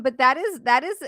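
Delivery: ordinary talk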